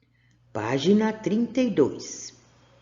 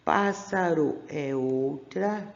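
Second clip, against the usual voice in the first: slow, words apart; slow separated reading; slower than usual. Shown as a demonstration. Teacher shows how to split joined words, slowly. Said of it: "Que nem aqui, ó".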